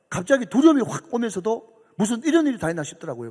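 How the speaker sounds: usual speaking voice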